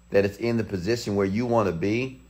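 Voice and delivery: normal talking voice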